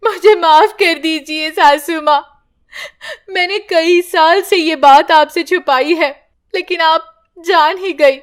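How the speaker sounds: weeping confession; crying. Acted. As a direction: sob the apology to mother-in-law. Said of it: "Padma रोने लगती है".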